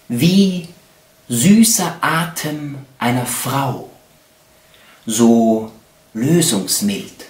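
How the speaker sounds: slow, expressive poem reading with long pauses; poetry recitation. Acted. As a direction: recite love poetry, swelling and falling with each phrase. Recites love poems.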